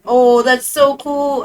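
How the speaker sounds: normal talking voice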